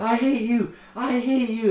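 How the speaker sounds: high-pitched imitation of a woman raging; mock furious wife. Girl beating on her husband, mock furious cries. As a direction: cry it out high, as in a fight.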